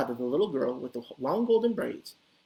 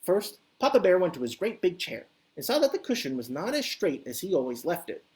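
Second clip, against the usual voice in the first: usual speaking voice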